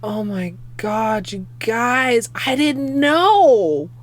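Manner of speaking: slow, drawn-out amazed disbelief; overwhelmed. Real, not acted. Streamer overwhelmed telling her chat, slow and high. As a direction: let it stretch out, moved.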